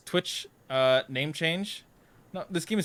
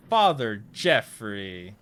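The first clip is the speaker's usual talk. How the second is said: slow, stretched-out Father Jeffrey; oddly drawn out; 5 semitones lower, 4 dB louder, much slower than usual. Real, not acted. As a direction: draw out "Father Jeffrey" slowly, a bit lower. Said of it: "I don't know why I said it like that".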